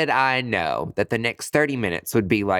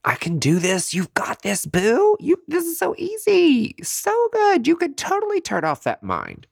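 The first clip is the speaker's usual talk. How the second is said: self pep talk. Retold or imitated. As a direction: hype yourself up like a cheerleader. Pep-talking herself before meditation.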